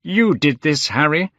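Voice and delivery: high, forceful accusation; angry. A narrator's reading. Painter blaming Lord Henry, angry. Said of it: "said the painter angrily".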